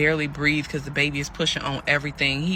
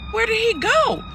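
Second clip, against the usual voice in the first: high-pitched, baffled retelling; bewildered; 4 dB louder than usual. Retold or imitated. Pregnant woman left at the courthouse, high bewildered cry. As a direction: squeal the question in mock disbelief.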